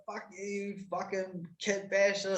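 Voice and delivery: lightly voiced cheeky brother swearing; mock swearing brother. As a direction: sneer the insult at the father. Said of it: "he's swearing at my man".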